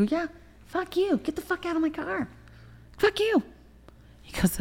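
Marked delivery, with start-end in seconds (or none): 0.0-3.4 very high, strained mock scream; retold shrieked curse. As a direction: screech it without full volume. Retold screaming match in car.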